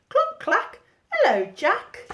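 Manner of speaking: very high, clucking hen voice. A narrator's reading. The fat hen greets Jack, high clucking voice. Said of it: "said the hen".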